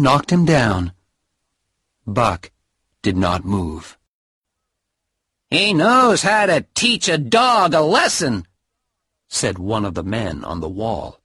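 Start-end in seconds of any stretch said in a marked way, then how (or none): 5.5-8.5 onlooker voice. Acted. Man on the wall remarks.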